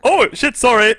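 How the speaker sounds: loud, high laughing apology; startled. Real, not acted. Player apologizing to a stranger's family, loud and startled. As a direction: blurt it out, caught red-handed.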